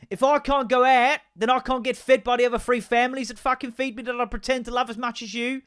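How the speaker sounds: high, loud voice of a protesting cat; indignant cat. Acted. Cat complaining to its owner about getting fed, indignant voice. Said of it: "a cat's like".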